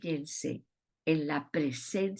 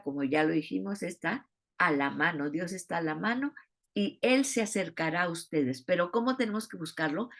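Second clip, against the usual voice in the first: ordinary talk, nothing marked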